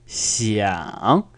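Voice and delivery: "Xiang" dipping down, then swinging clearly up; exaggerated down-and-up on "Xiang"; emphatic third tone. Shown as a demonstration. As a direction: draw out "Xiang" with a full fall-then-rise tone. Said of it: "I've gone down and then up".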